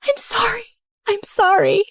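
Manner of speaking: begging through tears; pleading apology. Acted. Repeated tearful apology.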